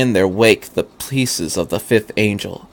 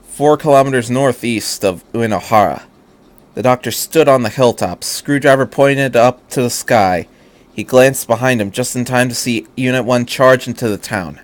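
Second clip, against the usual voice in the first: plain reading voice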